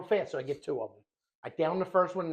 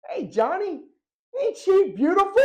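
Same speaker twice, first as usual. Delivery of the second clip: lightly voiced dad, higher and louder; teasing dad; 12 semitones higher, 10 dB louder than usual. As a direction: play up mock admiration. Dad's teasing admiration, acted.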